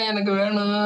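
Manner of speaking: high mock wail of a child's demand; stubborn wailing child. Child begging parent for a balloon, mock crying. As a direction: imitate a kid whining for a toy. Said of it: "அடம் பிடிச்சு அழுகிறது".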